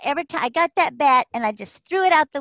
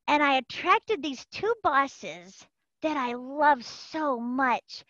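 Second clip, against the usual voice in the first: ordinary delivery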